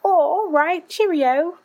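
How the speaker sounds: high, prim mock British accent; teen son's chirpy British cheerio. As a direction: play the posh British boy in a British accent. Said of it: "her son who's like".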